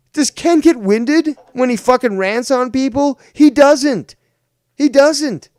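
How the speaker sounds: loud, high and emphatic; indignant insistence. Real, not acted. Indignant rhetorical question and answer.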